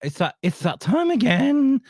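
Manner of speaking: high, slow, quivering show-intro voice; quivering announcer voice. Shown as a demonstration. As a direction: mimic the jingle's dramatic announcer, quavering. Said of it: "That little quivering in my voice".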